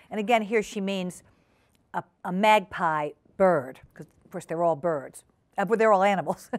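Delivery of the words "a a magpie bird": usual speaking voice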